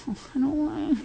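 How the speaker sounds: small, high, soft mock whine; hammed-up childish reluctance; whiny refusal. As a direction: sulk like a little child, high and quiet. Acting out an impatient tantrum.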